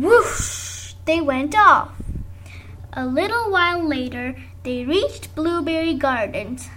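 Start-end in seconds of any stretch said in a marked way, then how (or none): none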